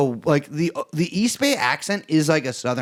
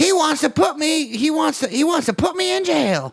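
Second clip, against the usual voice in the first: much higher mocking Kamala voice; 12 semitones higher than usual. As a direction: squeak it out like a whiny candidate.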